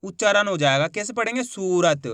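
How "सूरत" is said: सूरत with the "oo" stretched out; drawn-out long ू. Shown as a demonstration. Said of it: "खींच के पढ़ेंगे".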